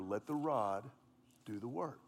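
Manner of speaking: fading low self-reminder; remembered mantra. Retold or imitated. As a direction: repeat it to yourself, dying away. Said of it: "And I remember".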